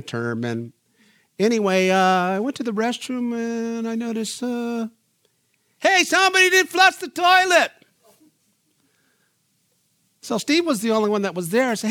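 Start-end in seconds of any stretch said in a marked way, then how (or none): 5.8-7.7 high, loud, startled call; a sudden high exclaimed complaint; exclaiming surprise. Retold or imitated. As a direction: announce the discovery in mock outrage. Retold discovering unflushed toilet.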